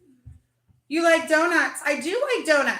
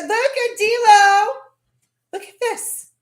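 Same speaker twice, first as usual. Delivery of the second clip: excited call; 9 semitones higher, 8 dB louder, slower than usual. Real, not acted. Showing off the cookie.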